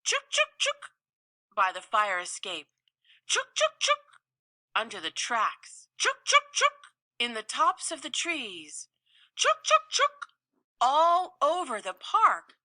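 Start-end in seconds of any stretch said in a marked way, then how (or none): 0.0-10.3 high chattering squirrel scold; sharp high squirrel chuks. Acted. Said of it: "went to raise the alarm".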